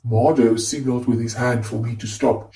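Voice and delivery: ordinary delivery, nothing marked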